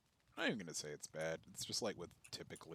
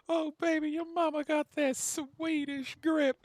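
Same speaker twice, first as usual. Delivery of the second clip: much higher, louder, slow sleazy croon; sleazy clown; 13 dB louder than usual. Retold or imitated. Clown taunts the guitarist about his mom, slow high sleazy croon. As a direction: leer it out like a creepy clown. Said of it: "the fucking clown dude's just like".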